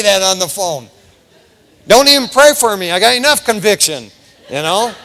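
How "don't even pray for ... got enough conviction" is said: loud, mock-indignant refusal; imitated mad backslider. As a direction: push them away loudly, half in jest. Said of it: "they got mad".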